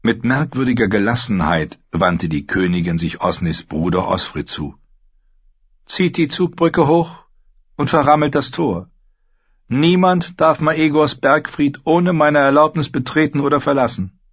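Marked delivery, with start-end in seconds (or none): 5.9-14.2 high-pitched royal command; commanding queen. A narrator's reading. Queen orders the guard to raise the drawbridge, commanding.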